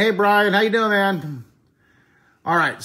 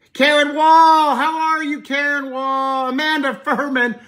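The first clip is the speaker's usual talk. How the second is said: excited greeting; 10 semitones higher, 7 dB louder, slower than usual. Real, not acted. Greeting viewer joining live stream.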